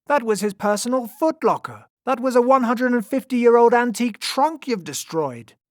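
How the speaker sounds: high, loud outrage; outraged cry. A narrator's reading. Lion tamer mourns the destroyed trunk, crying out loudly. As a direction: scold them at full pitch, aghast. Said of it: "cried the lion tamer".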